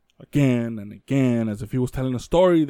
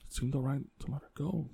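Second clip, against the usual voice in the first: much softer, hushed under the breath; whispering to himself; 13 dB quieter than usual. Retold or imitated. Stunned father whispering to himself, hushed and soft. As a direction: murmur it to yourself in shock. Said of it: "they could hear him just be like".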